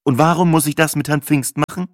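miffed customer. A narrator's reading. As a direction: challenge the clerk, a touch peeved. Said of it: "mein Tonfall von verschnupft".

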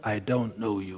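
curt low stonewalling; cold low reply. Retold or imitated. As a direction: brush him off, low and quiet. Chief facing down the ambassador.

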